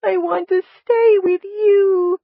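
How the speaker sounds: high, loud, drawn-out wail; slow, tearful high-pitched plea; moaned. A narrator's reading. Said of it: "she moaned to the big man".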